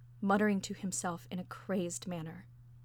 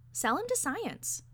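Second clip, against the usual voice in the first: high, slow lilt on the idea; eager suggestion; 10 semitones higher, slower than usual. A narrator's reading. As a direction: pitch it up and stretch it out. Narrator's character urges a new plan.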